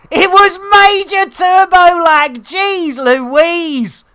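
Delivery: high, loud exclamation; real full-voice cry of amazement; excited shout. Real, not acted. Calls out streamer name in excitement.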